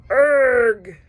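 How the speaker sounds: a hard straining grunt under weight; straining groan. A narrator's reading. Snake holding up a bear, straining groan. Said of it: "Kaa groaned".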